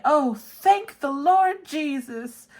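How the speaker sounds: slow, high, loud gushing thanks; mock relief. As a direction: overact the relief to the Lord. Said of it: "And I say".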